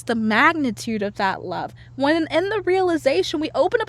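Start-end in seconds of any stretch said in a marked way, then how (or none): none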